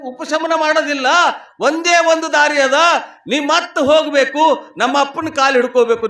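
high, urgent sermon storytelling; full-voiced preaching. Wife urging sick husband toward the guru, full-voiced preaching. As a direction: preach her counsel as a ringing appeal.

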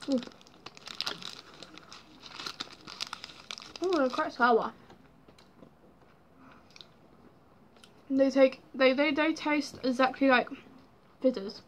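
talking between bites; chewing candy. Real, not acted. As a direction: mouth partly full. Seen 0: Lips pressed and working after eating candy, held at mouth.

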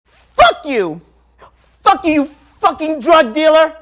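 screamed high and loud with hatred; loud, hate-filled screaming of a seven-year-old; furious screaming child. Retold or imitated. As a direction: hurl it at him in a child's rage. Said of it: "with all the anger and hatred".

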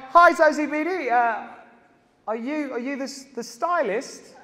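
usual speaking voice

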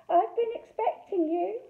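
a sweet high coo hiding a witch. A narrator's reading. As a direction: lure them in sweetly. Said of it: "she cooed".

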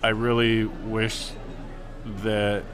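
ordinary talk, nothing marked

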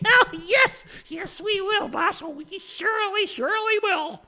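high-pitched cackling minion voice; goblin henchmen. Acted. Henchmen eagerly obey their goblin boss, high cackling voice.

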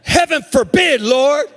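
high, indignant outburst as Peter; loud exclamation. Retold or imitated. Peter rebukes the Lord, loud exclamation. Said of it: "the reason I said it loud like that".

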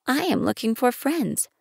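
little prince. Acted. Narrator voicing little prince.

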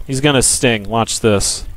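ordinary talk, nothing marked

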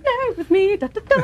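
high, giddy babble; gleeful reveal. Retold or imitated. Actress revealing her prank to the producer, gleefully. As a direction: burst out with the secret, delighted.

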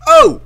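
short, very high, loud "oh"; startled yelp. Real, not acted. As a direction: yelp in sudden shock. Startled by the game.

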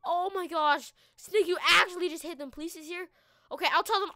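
normal talking voice

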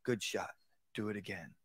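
quiet, low, even praise; calm low coaching. Shown as a demonstration. Range coach calmly praising a shot, low and quiet. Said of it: "when you're teaching someone to shoot".

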